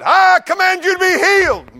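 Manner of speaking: hammed-up healer shouting the command; mock healing command.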